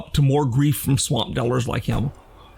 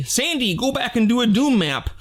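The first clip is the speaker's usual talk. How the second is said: higher-pitched quote of fans' request; lightly voiced pleading fans; 7 semitones higher than usual.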